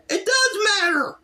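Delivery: high, exasperated protest. Real, not acted. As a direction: insist it does matter, fed up. Ranting about the breakup.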